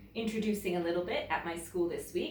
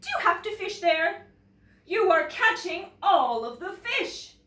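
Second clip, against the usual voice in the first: higher, louder indignant bear; complaining bear; 10 semitones higher, 7 dB louder than usual. Acted. Bear scolding the polar bear for catching all the fish, indignant. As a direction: protest that he's taking the fish. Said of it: "said Bear".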